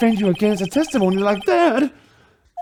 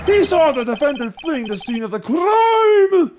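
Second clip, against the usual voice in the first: dramatic accusation; 10 semitones higher than usual. Acted. Prosecutor accusing the defendant, booming high. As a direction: hurl it at the defendant.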